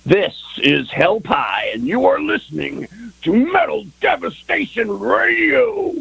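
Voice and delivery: slow, soaring announcer voice rising high; radio station tag. Pretend. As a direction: boom it out like a radio ident. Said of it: "make us another station tag".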